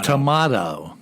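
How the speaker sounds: tomato said tuh-MAH-toh, low and drawn out; tomato with an open ah in the middle; alternative pronunciation. Shown as a demonstration. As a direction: stretch tomato on a broad 'mah'. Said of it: "some people say".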